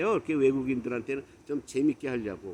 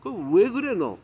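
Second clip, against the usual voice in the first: higher, heated scolding; re-enacted indignant rebuke, pitch raised; retold angry outburst; 5 semitones higher, 4 dB louder than usual. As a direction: tell off a friend, building louder. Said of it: "그러니까 이제 제가 화를 낸 거예요".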